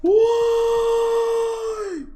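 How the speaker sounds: a long stretched despairing why; anguished high why. Real, not acted. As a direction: cry it out in disbelief at the sky. Outraged at the color.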